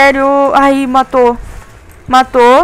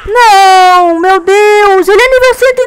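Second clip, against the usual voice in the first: panicking gamer; 6 semitones higher, slower than usual. Real, not acted. Boss level 130 kills pets.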